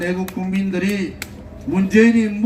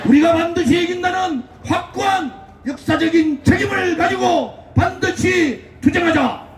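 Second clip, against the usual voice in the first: rally speech; 9 semitones higher than usual. Acted. Fiery rally speech to crowd.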